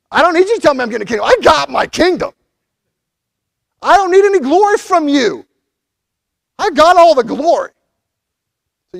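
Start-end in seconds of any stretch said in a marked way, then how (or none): 0.1-7.7 1 bold declaration. Acted. Voicing Jesus, rising declaration.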